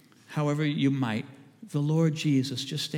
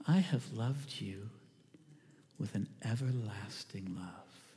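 slow whisper, much softer; whispered promise; 8 semitones lower, 10 dB quieter, slower than usual. Acted. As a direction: breathe God's promise gently to the listener. God's words whispered to listener.

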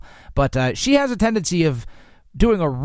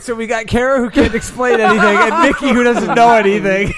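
laughing tease; 6 semitones higher, 5 dB louder than usual. Real, not acted. Teasing co-hosts, laughing.

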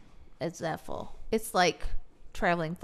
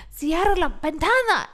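Spanish teacher command; 8 semitones higher, 7 dB louder than usual. Retold or imitated. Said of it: "And I'm like".

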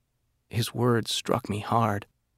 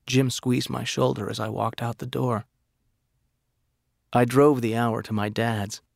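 ordinary delivery, nothing marked

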